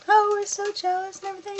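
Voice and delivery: high, airy put-on voice of the popular girls; mocking popular girls. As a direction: parody them as shallow and fake. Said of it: "they were like".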